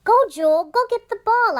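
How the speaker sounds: loud high yell at the dog; girl yelling at the puppy to fetch, loud and high. Acted. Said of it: "I yell".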